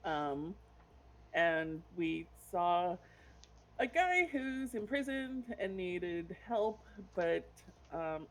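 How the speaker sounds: ordinary delivery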